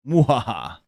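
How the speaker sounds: evil mwahaha laugh; a hammed-up villain cackle; sneering panto villain. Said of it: "Mwahaha? Is that what you give it?".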